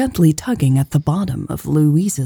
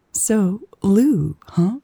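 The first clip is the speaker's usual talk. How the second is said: higher playful tease; teasing; 4 semitones higher, slower than usual. Acted. Elle to Louise about her nickname, teasing. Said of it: "Elle teased".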